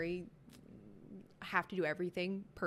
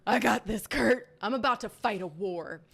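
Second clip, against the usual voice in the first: loud, fired-up battle cry; ketchup-soaked cashier, loud and defiant; defiant yell; 7 dB louder than usual. Retold or imitated. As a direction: shout it like going to war. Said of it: "I was like".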